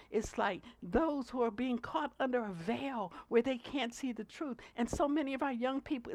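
ordinary talk, nothing marked

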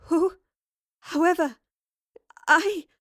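high, halting bewilderment; trembling. A narrator's reading. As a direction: stammer it out in shocked single words. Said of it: "Jane Bigwither trembled".

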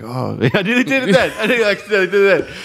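high-pitched laughing fluster; amused alarm. Real, not acted. Catching themself before slipping into an accent, amused alarm. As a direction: blurt it out, high, quick and laughing. Nearly slips into accent while quoting.

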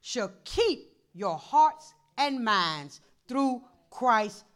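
slow, loud solemn reading; scripture reading. Acted. Pastor reading Philippians to the church, slow and loud. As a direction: proclaim the verse slowly.

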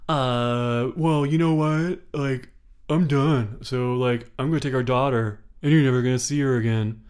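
mock ultimatum. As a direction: lay down the law like a smug husband. Husband threatening to leave.

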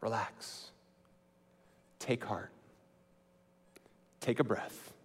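calm a panicked friend quietly; soothing. Acted. Jesus calming his frightened disciples, soft and soothing.

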